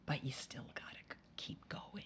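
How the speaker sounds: near-whisper, very soft; hushed urging. Real, not acted. Mother on persevering through bad feelings, hushed urging. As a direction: drop to a whispered push onward.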